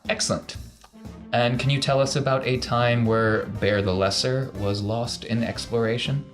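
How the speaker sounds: ordinary delivery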